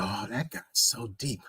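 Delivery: hushed, awed mock voice of the gullible; breathy starstruck mockery of weak minds. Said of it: "look at that and they go".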